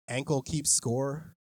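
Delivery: ordinary delivery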